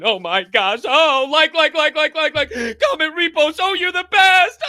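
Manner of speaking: high, loud, gushing mock excitement; squealing send-up of a social media fan; mocking gushing influencer. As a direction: parody someone begging for likes and reposts.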